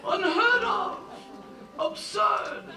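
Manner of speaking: high-pitched scoffing; indignant disbelief. Acted. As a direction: jump high in scandalized disbelief. Father indignant at the pledge.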